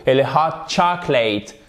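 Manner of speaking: ordinary talk, nothing marked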